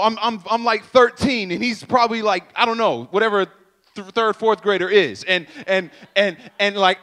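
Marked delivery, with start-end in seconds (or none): none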